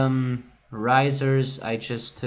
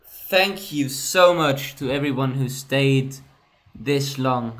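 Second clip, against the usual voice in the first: bright, loud and higher, buzzing with relief; loud happy wrap-up to the viewers; excited sign-off; 3 semitones higher, 8 dB louder than usual. Real, not acted. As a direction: announce the finish like a small celebration. Cheerful outro thanking viewers.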